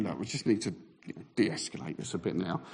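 low, soft inner voice; calm things down under your breath; de-escalating thought. Retold or imitated. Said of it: "so I'm thinking".

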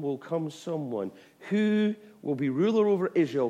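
ordinary talk

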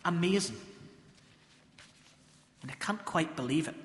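ordinary talk, nothing marked

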